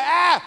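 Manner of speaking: high mock infant whine; mock baby whine. Shown as a demonstration. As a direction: whimper like a baby. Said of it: "babies are".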